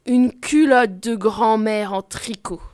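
ordinary delivery

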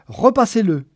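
high, loud imperious order; demanding. A narrator's reading. Geneviève wants the film rerun, demanding. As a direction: demand it like a spoiled customer. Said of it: "exigea-t-elle".

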